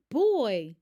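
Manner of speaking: loud burst of exasperation; loud fed-up boy; exasperated exclaim. A narrator's reading. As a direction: blurt it out, worn out by the chase.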